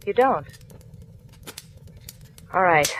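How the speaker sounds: falling, thoughtful you don't; musing echo. Acted. Marla mulling over Harry's answer, falling and thoughtful. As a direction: let it drop, accepting it.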